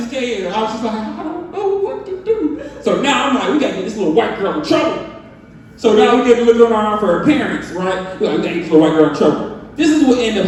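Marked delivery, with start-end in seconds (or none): 0.9-2.6 higher, softer, laughing panic; mock scared dad. 3.5-5.0 fast, urgent scheming; fast plotting to his wife; rushed plotting. Retold or imitated. 8.4-9.5 even faster, lower scheming; rapid plotting with wife. Retold or imitated. Repeated plotting.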